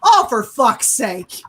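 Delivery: weary retold curse; exasperated mom. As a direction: imitate a mother who has had it. Mom sees the wound, exasperated.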